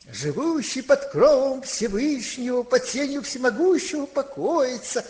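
psalm declaimed high and loud; loud recitation. Retold or imitated. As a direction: recite it by heart for all to hear. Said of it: "начинает громко наизусть говорить".

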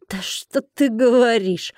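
slow, drawn-out mock question; condescending. A narrator's reading. Dragon mocks Colin's defiance, condescending. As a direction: stretch it out with patronising disbelief. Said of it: "снисходительно уточнил мужчина".

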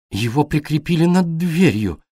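shaken, forcing the words out; struggling to speak. A narrator's reading. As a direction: shaken woman forcing out words beside the fallen stone. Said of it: "с трудом вымолвила она".

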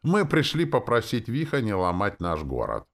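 joyful holler. A narrator's reading. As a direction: holler it happily to a crowd. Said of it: "радостно заголосил Перч". (радостно и громко голосит)

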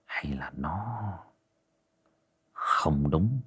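low, uneasy mutter; muttering to himself. Acted. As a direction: mumble it half to yourself. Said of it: "ông bần thần lẩm bẩm".

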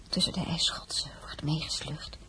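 fading into a near-whisper; hushed horror. Acted. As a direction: let the grim account die away. Grim bulletin trails into horror. (wegstervend, bijna fluisterend)

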